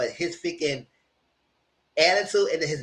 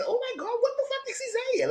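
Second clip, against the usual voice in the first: high, shocked quoting of the women; shocked ladies; 5 dB quieter than usual. Retold or imitated. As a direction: squeak it up high like a stunned woman. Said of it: "ladies say".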